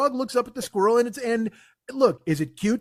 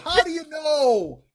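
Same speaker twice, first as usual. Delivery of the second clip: higher, slow, put-on whine; mock the question in a silly voice; mock whiny retort; 6 semitones higher, slower than usual. Mocking the video narrator's claim, slow whiny put-on voice. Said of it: "repeated retort".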